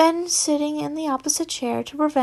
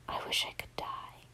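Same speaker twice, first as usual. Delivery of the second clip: very soft, breathless whisper of despair; 18 dB quieter than usual. Acted. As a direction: barely breathe the words out.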